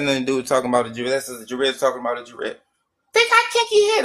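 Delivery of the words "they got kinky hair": squeaky falsetto mimicry of critics; high-pitched mocking quote; mocking imitation. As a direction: parrot them in a very high mock voice. Mocking opponents' talk about hair.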